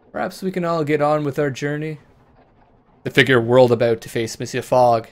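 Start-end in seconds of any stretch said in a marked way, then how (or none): 0.1-2.0 higher, reasonable suggestion; sensible. Acted. Master to the bandit behind a standoff, sensibly, pitched higher. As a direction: offer it sensibly to defuse things. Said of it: "my master said sensibly".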